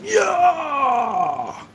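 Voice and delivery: high far-off yell of triumph; mock triumphant yell. Mocking the rival, a high game-style yell. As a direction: belt out a cartoon victory cry. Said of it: "You're like".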